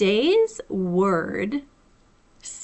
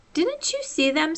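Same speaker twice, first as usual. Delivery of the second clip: a little surprised; 7 semitones higher than usual. A narrator's reading. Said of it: "a little surprised".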